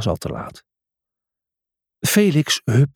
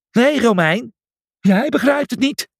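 defiant shout; 11 semitones higher, 3 dB louder than usual. Acted. Raging boar defies the Roman.

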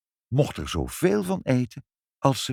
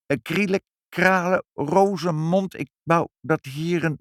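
nervous spell; 7 semitones higher than usual. A narrator's reading. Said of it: "maar ditmaal erg zenuwachtig".

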